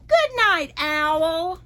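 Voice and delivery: usual speaking voice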